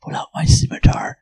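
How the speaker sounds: sneaky hushed whisper. Acted. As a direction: breathe it, no voice at all. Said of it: "Why are you whispering?".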